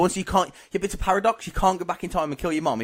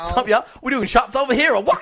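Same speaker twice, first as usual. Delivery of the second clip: higher, louder mock American party greeting; mock American accent; 7 semitones higher, 6 dB louder than usual. Co-host practising to pass as American, hammed-up American accent. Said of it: "pass myself off as American".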